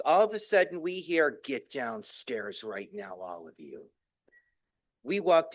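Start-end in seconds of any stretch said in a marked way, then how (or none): 1.4-3.9 low, slow stern call down the stairs; a hint of an angry mother summoning; stern mother command. Mother orders the kids downstairs, low and slow.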